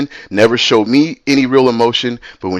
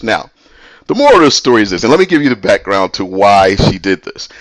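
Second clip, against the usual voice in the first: ordinary talk, nothing marked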